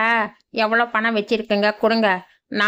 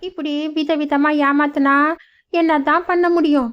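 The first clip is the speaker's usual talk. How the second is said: put-on higher old mother, exasperated; lamenting old mother; 6 semitones higher than usual. Old mother lamenting being cheated, higher. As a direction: throw up your hands at the cheating.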